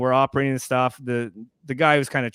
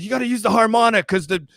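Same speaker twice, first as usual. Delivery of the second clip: retold yell; 7 semitones higher, 3 dB louder than usual. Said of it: "He's yelling at me".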